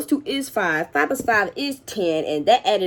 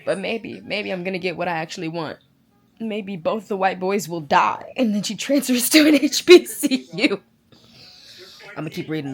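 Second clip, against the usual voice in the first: usual speaking voice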